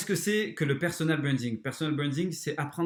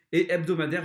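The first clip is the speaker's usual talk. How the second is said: hebdomadaire leaned on, a bit louder; stress on hebdomadaire; 2 semitones higher, 4 dB louder than usual. Real, not acted. Coach telling viewers to track weekly, hebdomadaire stressed. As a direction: press the word hebdomadaire home. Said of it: "j'insiste bien là-dessus".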